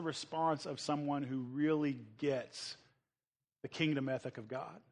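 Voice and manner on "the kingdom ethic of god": usual speaking voice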